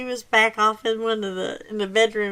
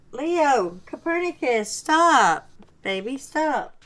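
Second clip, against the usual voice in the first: higher-pitched, falling commands; scolding a pet; 4 semitones higher, slower than usual. Real, not acted. Owner telling her misbehaving dog to stop, scolding. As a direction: call the dog off, pitch raised.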